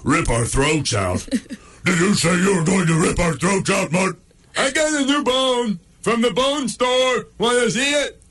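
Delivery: the pit bull challenges, the chihuahua chirps; tough dog voices. Acted. Pit bull confronts the coned dog over the threat.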